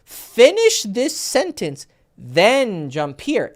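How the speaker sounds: very slow, emphatic, drawn-out delivery; fully said words. Shown as a demonstration. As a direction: finish each word before the next. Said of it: "fully say your words".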